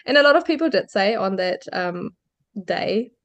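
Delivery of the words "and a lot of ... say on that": ordinary delivery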